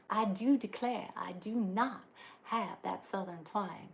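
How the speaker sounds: slow, hammed-up Southern drawl on I do declare; playful put-on Southern belle accent; mock Southern twang. Joking to viewers that she lacks the accent, mock Southern accent.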